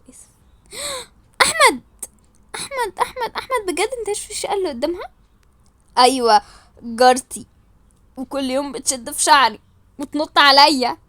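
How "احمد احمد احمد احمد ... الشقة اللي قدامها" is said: gasp, then high, hushed pleading; high, astonished questioning; breathless disbelief. Acted. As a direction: gasp and press him for the truth. Shocked question to Ahmad.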